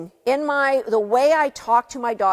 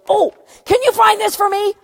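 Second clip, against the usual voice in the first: high, bright sudden-idea request; scatterbrained boss; 11 semitones higher, 7 dB louder than usual. Retold or imitated. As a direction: chirp it out like an impulsive boss. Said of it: "Susan gets a squirrel idea and goes".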